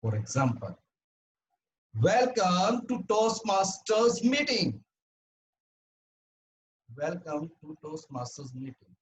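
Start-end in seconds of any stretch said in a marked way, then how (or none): 2.0-4.8 big, slow, high-pitched welcome; loud volume demo. Shown as a demonstration. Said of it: "how loud we speak". 7.0-8.9 soft, small-voiced welcome; low volume demo. Shown as a demonstration. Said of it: "how loud we speak".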